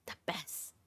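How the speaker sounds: whispered savoring; hushed, dreamy praise; whispered delight. Retold or imitated. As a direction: breathe it out like a perfect bite. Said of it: "I was like".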